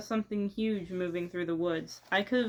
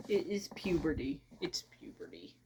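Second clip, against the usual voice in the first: whispering; 4 semitones lower, 7 dB quieter than usual. Pretend. Jokey whispered confession about voice.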